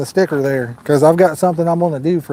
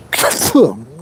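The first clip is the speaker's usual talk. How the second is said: sudden harsh cough; 3 semitones lower than usual. Real, not acted. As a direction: cough on a swallowed bug. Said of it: "I just swallowed a bug".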